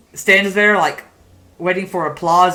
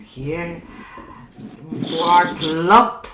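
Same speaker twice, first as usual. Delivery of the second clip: hammed-up ugly puppet sister, slow and drawn out; 丑陋木偶; slower than usual. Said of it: "the ugly puppets go".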